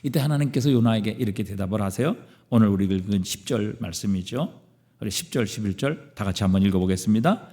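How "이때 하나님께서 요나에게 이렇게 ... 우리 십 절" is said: ordinary talk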